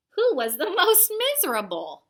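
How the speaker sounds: climbing storyteller excitement; rising excitement. A narrator's reading. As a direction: whip it up to a peak. Building monster rivalry list.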